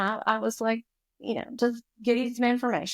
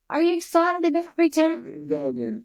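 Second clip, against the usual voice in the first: higher mock-bright question from the parent; mock cheerful parent; 8 semitones higher, 4 dB louder than usual. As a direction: echo the parent's chirpy greeting. Parent in parking lot asks.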